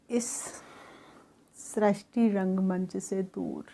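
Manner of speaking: calm, even meditation guidance; guided meditation. Acted. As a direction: lead the soul gently inward and back. Leads a guided soul meditation.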